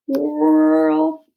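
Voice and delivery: high loud swirling word; high whirling sound. Shown as a demonstration. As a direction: make the word whirl upward.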